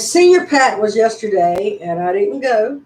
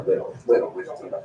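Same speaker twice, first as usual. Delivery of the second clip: whispered playful chant; whispered pet talk; 7 dB quieter, faster than usual. Real, not acted. Egging the dog on to wiggle, whispered.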